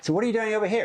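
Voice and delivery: high-pitched put-on American voice; American small talk, voiced high; American accent. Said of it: "Do you like my American accent?".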